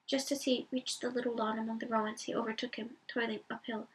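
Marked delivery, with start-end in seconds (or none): none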